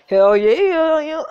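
loud laughing cheer; excited cheer. Real, not acted. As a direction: whoop it out, laughing. Cheering along with character.

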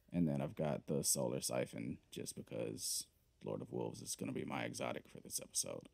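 ordinary talk, nothing marked

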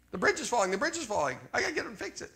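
hammed-up frantic alarm, pitch jumping high; high, urgent pretend fretting; mock panic. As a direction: play someone rushing to avert disaster. Acts out panicky urge to fix.

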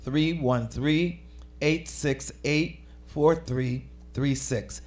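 slow, spaced-out phone number. Real, not acted. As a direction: read each group of digits slowly, with gaps. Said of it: "say it a little bit slower".

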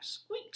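very high breathy squeak; mouse squeak. Acted. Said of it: "said the mouse".

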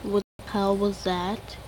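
usual speaking voice

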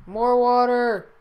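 slow, flat robot voice. Acted. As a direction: speak it like a robot. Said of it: "Nice robot voice".

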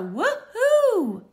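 high, loud whoop of joy; excited woohoo. Acted. Luna blasts off in her spacecraft, joyful high whoop. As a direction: launch the cheer like a rocket. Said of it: "blasts off with a".

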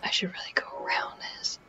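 barely voiced whisper; hushed sneaking. Real, not acted. Player deciding to go around danger, whispering. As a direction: whisper to yourself while creeping round.

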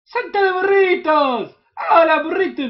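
high, loud delighted cry at the donkeys; thrilled squeal. Real, not acted. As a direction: squeal with joy, stretched out. Finds donkeys, greets them.

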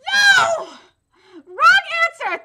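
high, loud, explosive rejection; outraged shout. Acted. Rejecting a wrong answer to the confession, outraged shout. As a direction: yell the correction at full volume. Acted rebuke of a botched rehearsal.

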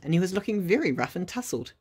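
usual speaking voice

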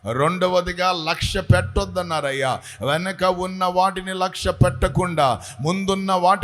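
earnest, steady prayer voice. Real, not acted. Pastor leads the congregation in an earnest prayer. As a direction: pray aloud for the whole church.

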